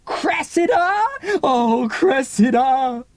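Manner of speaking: long drawn-out cry of her name; crying out for Cressida. Acted. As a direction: call her name in anguish, slowly. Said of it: "rushing up the stairs crying".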